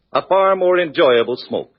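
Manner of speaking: ordinary talk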